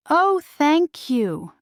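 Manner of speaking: high, slow, overjoyed thanks; grateful delight. A narrator's reading. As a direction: let the thanks spill out slowly. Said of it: "said Cinderella".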